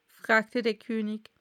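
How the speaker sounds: usual speaking voice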